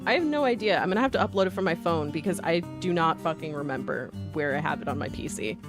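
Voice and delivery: ordinary talk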